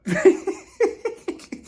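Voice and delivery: a high, smitten, breathy giggle; high giddy giggle. Retold or imitated. Chanel Ayan swooning over Akeem, high giddy giggle. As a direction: giggle like a starstruck fan. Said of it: "She was just like".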